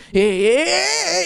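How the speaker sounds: high, strained awkward yeahs; flustered yeahs on seeing her ex. Retold or imitated. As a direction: squeak out yeahs caught off guard. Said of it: "where I'm like".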